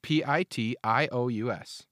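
P I T I O U S spelled letter by letter; spelling it out. Shown as a demonstration. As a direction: spell P I T I O U S out, one letter at a time. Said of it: "It's P R O".

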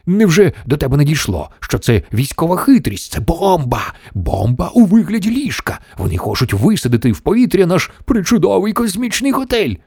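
high-pitched alarmed tirade; yelling. A narrator's reading. The President warns of a bomb disguised as a bed, yelling. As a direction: rant it in mounting outrage. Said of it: "зарепетував президент".

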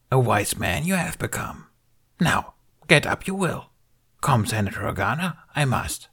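high, croaky little Jedi master; Yoda voice. A narrator's reading. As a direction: speak as a wise old Yoda. Yoda speaking in the story.

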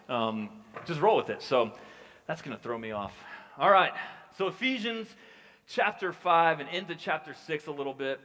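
normal talking voice

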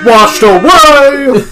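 high, loud, stretched-out wail; over-dramatic echo shout; hammed-up cry. A narrator's reading. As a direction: belt it out, dragging the last word. Repeated as a mock dramatic cry.

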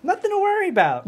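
high, quick and airy brush-off; breezy dismissal. Acted. Kirk brushes off the loan sharks, high and quick. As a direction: toss it off like it's nothing.

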